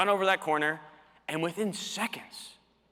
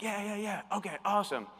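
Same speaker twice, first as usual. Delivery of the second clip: a hint of a higher, softer agreeable customer; eager customer; 5 semitones higher, 4 dB quieter than usual. Convinced customer agreeing with the salesman, lightly voiced eager yeses. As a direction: nod along, totally sold.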